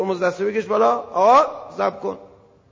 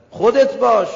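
emphatic call; 4 semitones higher, 9 dB louder than usual. Acted. Preacher urging be yourself.

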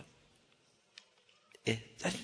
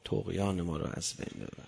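much lower and much softer, almost breathed; hushed prayer; 10 semitones lower, 11 dB quieter than usual. Shown as a demonstration. Preacher repeating the prayer softly to the congregation. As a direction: drop to a near-murmur on طغیان.

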